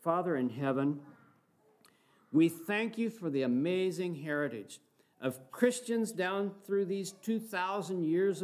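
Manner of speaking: steady, reverent public prayer voice. Acted. Pastor praying aloud for the congregation, reverent prayer. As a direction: pray for the whole church, earnest.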